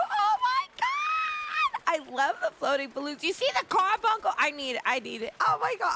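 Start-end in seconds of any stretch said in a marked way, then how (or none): 0.1-1.8 very high, slow shriek of joy; shrieking delight. Real, not acted. Streamer watching the creature show, high slow delighted shriek. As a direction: let it fly up into a shriek.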